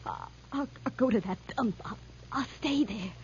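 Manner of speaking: low, soft, halting; frightened surrender. Acted. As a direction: give in meekly, stammering. Gives in to attacker.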